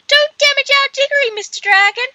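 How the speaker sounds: very high falsetto squeal of fangirls; swooning girls. Retold or imitated. Commentator mimics swooning girls begging the dragon, high squeal. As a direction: shriek it like a crowd of swooning girls. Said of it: "He's like".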